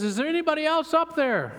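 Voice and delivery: the dangling man's nervous punchline question; timid comic plea. Retold or imitated. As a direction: ask it nervously, hoping for someone else. Man doubts God's offer, joke punchline.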